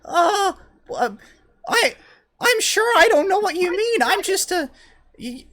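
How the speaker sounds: put-on very high, loud, flustered kid voice; fake little boy voice. Flustered gnome insists to the party in a very high boy voice. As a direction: stammer like a guilty child, gee willikers. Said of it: "and he goes".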